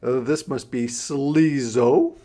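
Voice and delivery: ordinary delivery, nothing marked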